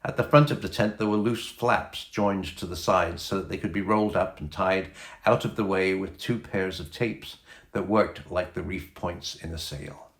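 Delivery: ordinary delivery, nothing marked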